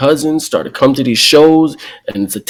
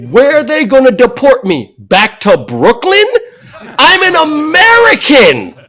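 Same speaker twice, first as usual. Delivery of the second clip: higher and louder, laughing in disbelief; indignant laughing protest; 11 semitones higher, 6 dB louder than usual. Retold or imitated. Son protesting to mom, laughing and loud. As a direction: laugh off the absurd threat. Arguing with mom about deportation.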